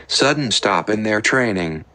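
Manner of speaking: ordinary delivery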